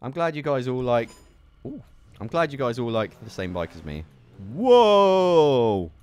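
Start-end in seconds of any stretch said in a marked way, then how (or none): 4.5-5.9 loud, high, long drawn-out whoa; big belted "whoa". Real, not acted. Gamer reacting to a stunt, loud whoa. Startled while riding in game.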